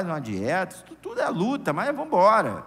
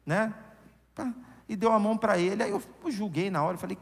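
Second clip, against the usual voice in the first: usual speaking voice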